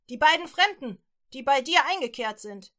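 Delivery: insist, loud and hard; demanding guards. Acted. Guards at the door demanding the strangers, loud.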